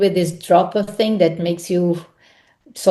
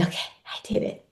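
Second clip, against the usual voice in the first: softer, fast breathless relief; relieved whisper; 12 dB quieter, much faster than usual. Retold or imitated. Actor finishing a role, whispering relief. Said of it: "And then I say".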